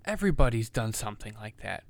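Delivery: loud, heated rant; really laying into it; angry rant. Real, not acted. Ranting about cancel culture.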